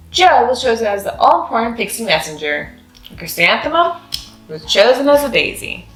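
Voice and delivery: ordinary delivery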